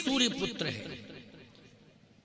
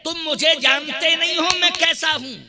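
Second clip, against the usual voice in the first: arrogant boast; 6 semitones higher, 6 dB louder than usual. Acted.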